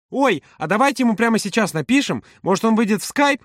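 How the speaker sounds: high, excited proposal; eager suggestion. A narrator's reading. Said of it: "предложила Маша". (восторженное предложение высоким голосом)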